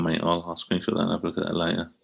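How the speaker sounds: ordinary talk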